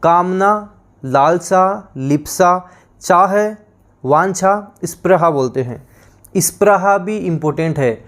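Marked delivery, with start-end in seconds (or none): none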